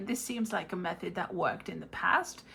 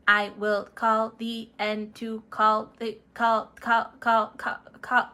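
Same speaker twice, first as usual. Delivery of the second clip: glitching robot; 4 dB louder, slower than usual. Acted. Robot glitches cutting transmission.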